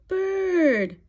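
plain reading voice

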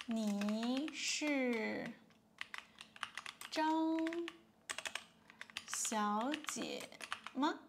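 你是张小姐吗 broken up word by word, slowly; teacher breaking the question into slow parts; slow syllable drill. Shown as a demonstration. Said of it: "喂".

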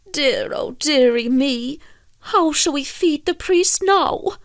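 high-pitched mild lament; dismayed cry. A narrator's reading. Fisherman laments losing the priest's supper, dismayed. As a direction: wail it lightly, pitch climbing. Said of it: "cried Michael as he stood up".

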